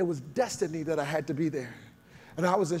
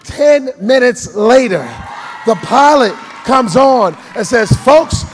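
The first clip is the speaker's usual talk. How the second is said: much higher and louder, slow and ringing; shouting sermon climax; 8 semitones higher, 19 dB louder, slower than usual. Acted. Pastor to congregation, roaring climactic preaching. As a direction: shout it out to the rafters.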